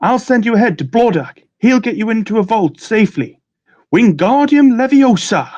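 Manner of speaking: high-pitched, dramatic instructions; wizard character voice. Acted. Bill sends riders ahead to the vault, high wizard voice. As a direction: keep it high, ending in an incantation. Acting Bill Weasley giving help.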